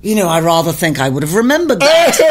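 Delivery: measured, plummy imitation swooping up at the end; David. Singer answering her accusation after long silence, imitated deadpan. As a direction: deliver it as a dry, considered rebuttal. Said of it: "and he goes".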